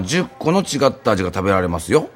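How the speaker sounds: ordinary delivery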